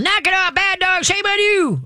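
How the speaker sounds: hammed-up yelling owner; mock screaming. Shown as a demonstration. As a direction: ham up a panicked owner scolding. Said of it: "Don't start screaming and yelling".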